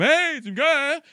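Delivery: crié plus aigu et plus fort; lance-le comme un rappel sec; reproche crié. Retold or imitated. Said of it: "faut que tu fasses comme". (reproche haussé en pleine dispute)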